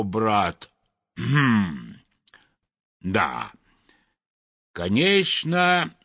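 lightly voiced, musing judge with long pauses; deliberate, doubtful reasoning; pondering chimney sweep. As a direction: think it through aloud, weighing each phrase.

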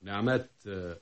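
low, subdued, halting admission; hushed and low, feeling for the words; reluctant confession. Acted. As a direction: confess it quietly, with hesitant pauses. Reluctant confession to his friend.

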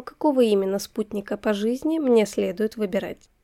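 plain reading voice

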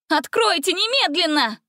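high shouted demand while pounding; shouting. A narrator's reading. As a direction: yell it, banging on the wall. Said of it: "колотить по стене с криками".

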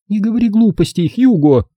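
high-pitched, mildly cross woman; angry. A narrator's reading. Zelda tells off her ex-husband, mildly angry. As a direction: scold him with a touch of annoyance. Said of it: "рассердилась Зельда".

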